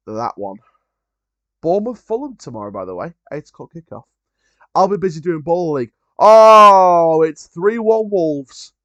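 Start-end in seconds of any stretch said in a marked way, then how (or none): 6.2-8.7 loud, high gasp of news, then slow; a real jolt at the score; surprised outburst. Real, not acted. Reacting to Wolves going three one up, surprised and loud.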